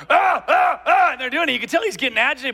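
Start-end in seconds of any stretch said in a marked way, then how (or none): 0.1-1.1 high, loud ape hoots; monkey calls. Retold or imitated. Teens and ape trading calls, high loud hoots. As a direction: hoot back like the teasing crowd. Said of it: "then they're like".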